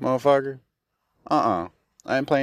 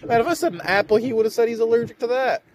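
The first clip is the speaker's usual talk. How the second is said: high, disbelieving outcry; can't believe it; much higher exasperated ranting; incredulous; 13 semitones higher, faster than usual. Real, not acted. Continued incredulous rant.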